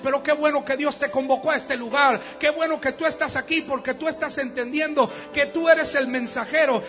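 rising to a shouted sermon climax; fiery preaching. Acted.

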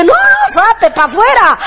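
high, sharp mock shoo of the babies; shooing kids away. To the church sisters bringing babies, a high mock shoo. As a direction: shoo them out in a high squeal.

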